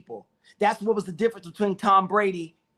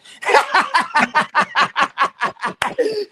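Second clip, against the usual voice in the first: high, loud, rapid cackling laugh; big laugh; 9 semitones higher, 6 dB louder than usual. Real, not acted. Cracking up at own joke about the graduation kids, loud laughter. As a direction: burst out in a long staccato laugh.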